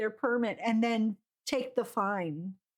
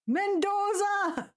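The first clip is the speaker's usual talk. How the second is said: "Mendoza!" called out higher, drawn out slowly; calling out; 7 semitones higher, slower than usual. Real, not acted. Streamer calling out the clue to chat, high and slow. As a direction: call "Mendoza!" out to the room, stretched.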